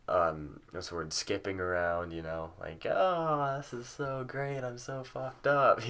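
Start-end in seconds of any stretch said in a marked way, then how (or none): 2.9-5.9 high, drawn-out blissed-out gush; giddy elation. Retold or imitated. Recalling skipping around high with a friend, mock giddy. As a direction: imitate being happily stoned, pitched well up.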